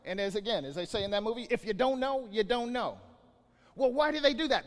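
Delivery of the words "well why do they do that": mock questioner. Mock dialogue question and answer.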